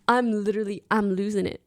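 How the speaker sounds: normal talking voice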